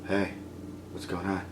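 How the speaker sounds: very soft, low murmur; low, hushed, offhand imitation; mock casual greeting. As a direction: drop right down for an underplayed hello. Mimicking the murderer's casual greeting.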